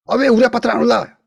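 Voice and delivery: high whiny complaint of an owner whose flat lags; annoyed owner. Retold or imitated. Owner of a flat that never rises, high aggrieved whine. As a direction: moan it up high like a fed-up homeowner. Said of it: "화가 나잖아요".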